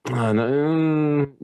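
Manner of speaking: ordinary talk, nothing marked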